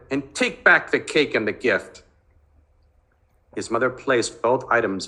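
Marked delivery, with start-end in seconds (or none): 0.1-2.0 angry demand. Acted. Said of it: "he shouted".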